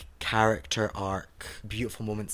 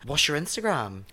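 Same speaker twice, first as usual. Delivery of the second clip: ordinary talk, nothing marked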